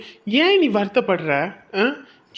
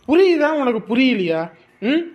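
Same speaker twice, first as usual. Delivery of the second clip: angry outburst; 4 semitones higher than usual. Acted. Husband losing patience with his crying wife, angry. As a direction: snap at her in frustration.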